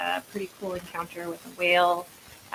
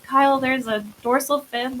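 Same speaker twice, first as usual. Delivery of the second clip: retold alarm, higher and louder; mock-panicked warning cry; freaking out; 6 semitones higher, 6 dB louder than usual. Angler warning Kyle of a dorsal fin, freaking out. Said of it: "I'm freaking out".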